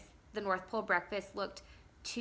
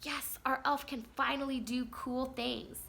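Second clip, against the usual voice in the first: put-on higher, slower kid voice; imitating her son; 5 semitones higher than usual. Mom imitating her son's excitement about the elf, higher kid voice. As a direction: play the eager little boy, pitched up. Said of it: "he goes".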